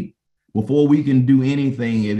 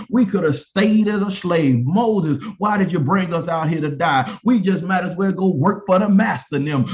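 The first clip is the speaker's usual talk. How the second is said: higher-pitched preacher's mock protest; acted complaint; 6 semitones higher than usual. Preacher as Israelites blaming Moses for the wilderness, acted complaint. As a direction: plead and complain as the aggrieved crowd.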